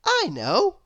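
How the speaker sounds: high, bright-eyed know-it-all cry; eager little girl voice. A narrator's reading. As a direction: pitch it way up, keen to answer. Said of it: "cried little Miss Brainy".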